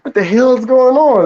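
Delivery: confused. Retold or imitated. A guest mocking the host's confusion, high and baffled. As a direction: act baffled at the strange guest. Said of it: "It's like".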